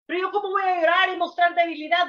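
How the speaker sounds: a hint of a proud skeptic asking; doubting objector. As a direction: rush out the excuse not to cry. Imagined doubter objects to crying.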